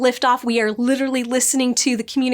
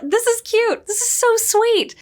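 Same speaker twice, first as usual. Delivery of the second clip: gushing; 10 semitones higher than usual. Real, not acted. Gushing over a sweet scene.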